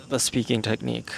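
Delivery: normal talking voice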